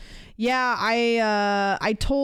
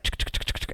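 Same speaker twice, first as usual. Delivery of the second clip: typing noises; 5 dB quieter, faster than usual. Retold or imitated. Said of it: "And she's like".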